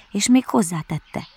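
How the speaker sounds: ordinary delivery, nothing marked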